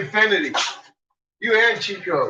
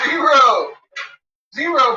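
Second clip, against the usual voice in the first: louder, higher zero; emphatic zero; 7 semitones higher, 3 dB louder than usual. Shown as a demonstration. Teasing the dog, loud emphatic zero. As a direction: punch the word Zero up high.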